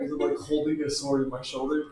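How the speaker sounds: ordinary delivery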